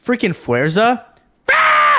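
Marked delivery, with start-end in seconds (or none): 1.5-2.0 sudden high yelp mocking her shouting; mock yelling. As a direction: parody a character who yells for no reason. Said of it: "like, yelling".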